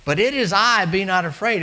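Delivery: high, loud, ringing reassurance; ringing proclamation. Acted. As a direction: call out over the storm to calm them. Preacher voicing Jesus, repeated.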